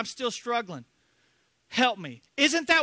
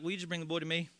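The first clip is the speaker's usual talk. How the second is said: gentle invitation; 5 semitones lower, 10 dB quieter, much faster than usual. Acted. Jesus voiced gently.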